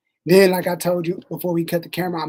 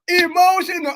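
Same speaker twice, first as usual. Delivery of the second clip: high, loud catchphrase impression; emotional damage guy; 9 semitones higher, 7 dB louder than usual. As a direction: blurt it like the viral meme guy. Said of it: "The emotional damage guy".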